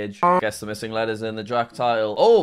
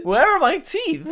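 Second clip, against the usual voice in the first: mock indignant; 5 dB louder than usual. Real, not acted. Mock outrage at no-teeth edit.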